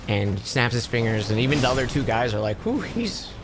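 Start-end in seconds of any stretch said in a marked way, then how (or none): 2.7-3.3 a high, soft whew of admiration; impressed whew. Retold or imitated. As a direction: breathe out an impressed whew, pitched up.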